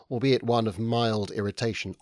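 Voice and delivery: plain reading voice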